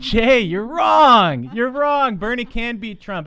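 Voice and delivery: high, loud, stretched-out call; emphatic rebuttal. Real, not acted. Host answering a doubting Facebook commenter, emphatic high call.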